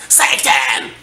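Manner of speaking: rasped shriek into the mic; raspy scream. Shown as a demonstration. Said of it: "let's scream some "Satan" into the microphone like this".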